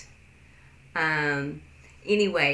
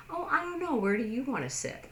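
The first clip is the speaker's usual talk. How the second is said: indecisive shrug; 7 semitones higher, 4 dB quieter, faster than usual. Retold or imitated. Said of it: "Which is what we do in English".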